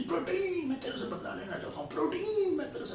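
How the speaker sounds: high, half-laughing cry for revenge; hammed-up wail of a wronged enzyme; mock shout. Trypsinogen crying out to protein for revenge, high mock wail. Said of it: "चिल्लाता है ऐसा कि".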